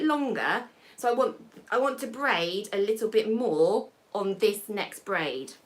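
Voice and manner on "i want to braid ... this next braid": normal talking voice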